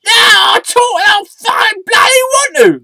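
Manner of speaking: very high, loud protest; defiant squeaky outburst. Acted. Sidekick told to shut up, defiant high-pitched shout. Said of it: "You just don't shut up".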